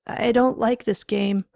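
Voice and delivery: uneasy protest. Acted. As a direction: protest with rising alarm. Uneasy man calling into dark.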